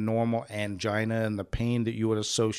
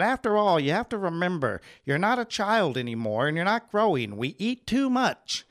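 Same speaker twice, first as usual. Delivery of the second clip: higher mock-scolding nurse voice; finger-wagging nurse; 6 semitones higher than usual. Nurses wagging the finger at the heart patient, higher mock scold. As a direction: lecture him like a nagging nurse, higher. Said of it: "they were wagging the finger at me".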